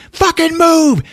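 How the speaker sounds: high, sudden yell; acted outraged shout; retold furious yell. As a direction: yell it high, shoving someone out of the way. Retold mother shoving man aside.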